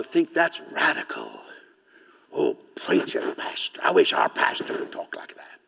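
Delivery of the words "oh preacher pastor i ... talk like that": breathy mock admirer whisper; hushed, swooning admirer of the preacher. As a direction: voice the gushing churchgoer in a hush. Mocking admirer voiced in sermon.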